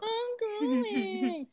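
high, slow, weepy and soft; mock crying kid. Kid crying to his parents, high and slow. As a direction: whimper it like a sulking child.

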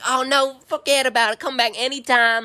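high, gushing southern waitress voice; southern twang. Retold or imitated. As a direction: wave it off in a southern twang, drawing out the end. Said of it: "she got a southern twang and she goes".

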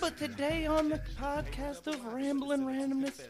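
booming showman intro; announcer voice. Acted. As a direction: hype it up like an emcee. Continuing the hammed-up intro.

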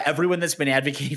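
ordinary talk, nothing marked

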